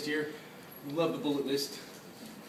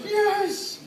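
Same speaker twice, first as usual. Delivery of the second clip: excited yes; 12 semitones higher than usual. Retold or imitated. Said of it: "And then I was like".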